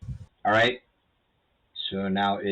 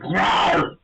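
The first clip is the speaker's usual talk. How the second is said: loud growling roar of pain; pained monster roar; 7 semitones higher, 4 dB louder than usual. Acted. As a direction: roar like a wounded beast. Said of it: "voicing the pain".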